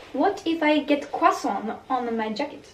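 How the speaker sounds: hammy French pronunciation of croissant; mock-French nasal ending on croissant; bad French accent. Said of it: "That was the worst French accent".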